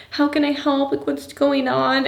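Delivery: put-on high, eager little-girl voice; helpful child. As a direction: offer help like a small kid. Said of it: "was like".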